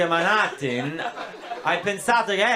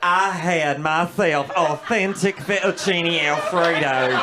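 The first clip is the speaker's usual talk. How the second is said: mock American lady voice, slow and louder; hammed-up Darlene from the Wawa; a proud tourist lady, drawn out; 4 dB louder, slower than usual.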